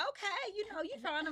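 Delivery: bright high-pitched okay. Retold or imitated. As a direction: lift it way up, easygoing. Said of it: "you like".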